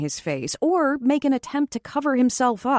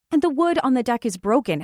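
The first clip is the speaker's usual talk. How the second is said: usual speaking voice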